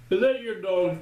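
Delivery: high, startled question; a retold old lady caught off guard; surprised old lady.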